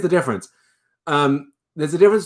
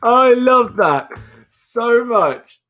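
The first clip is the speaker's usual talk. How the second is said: delighted; 11 semitones higher, 5 dB louder, much slower than usual. Real, not acted. Delighted by a viewer comment.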